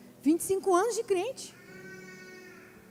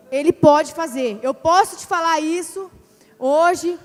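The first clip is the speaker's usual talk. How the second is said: louder, slow-building exhortation; preaching; 9 dB louder, slower than usual. Acted. Preacher urges the church that God can do it, loud exhortation. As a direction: drive it home to the congregation.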